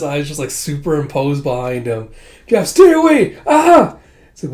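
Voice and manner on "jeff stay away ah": very high panicked screamed warning. Retold or imitated. Dragon warning friend away in panic, high scream. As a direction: shriek at him to stay away.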